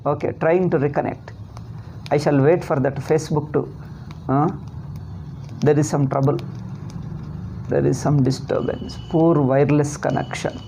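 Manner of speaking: normal talking voice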